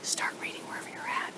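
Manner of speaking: put-on fast, breathy whisper to a child; whispered prompt. Shown as a demonstration. Teacher whispers to Leslie to start reading. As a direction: whisper it quickly beside her. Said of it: "come over here to Leslie".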